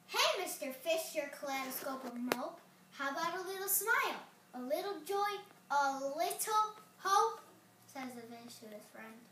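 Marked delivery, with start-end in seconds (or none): 0.1-7.4 pushy squid. Acted. Impolite squid pushes fish.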